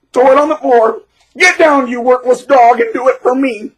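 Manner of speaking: high, loud mocking bully voice; mock bully commands. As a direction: sneer the orders like a petty tyrant. Voicing a tyrant bully's commands.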